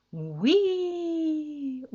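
long, high gleeful squeal; joyful swooping cry; exclaimed whee. A narrator's reading. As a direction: whoop mid-bounce, holding the vowel. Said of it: "udbrød hun igen".

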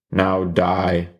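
usual speaking voice

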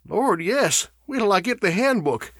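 high-pitched, agitated answer; urgent, pitched well up; alarmed. A narrator's reading. Burford to Charlie's questioner before fetching the handbook, alarmed.

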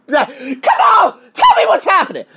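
high, loud retold demand; mock yelling. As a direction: shout it like begging for the news. Retold pleading with the tutor.

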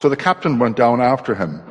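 ordinary talk, nothing marked

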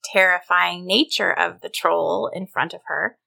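plain reading voice

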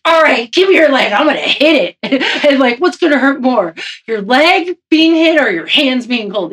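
coach imitation. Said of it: "he's like".

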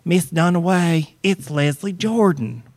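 a light take on Leslie Jordan saying his name carefully; Leslie Jordan impression. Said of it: "That's my Leslie Jordan impression".

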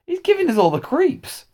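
high-pitched, creeped-out grumble; complaining dinner lady. A narrator's reading. Dinner lady telling the teacher the boy is creepy, complaining. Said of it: "went to Mister Majika to complain".

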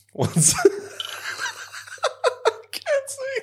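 high, teary laughing; crying with laughter. Real, not acted. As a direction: laugh till the eyes water. Crying laughing from spicy chip.

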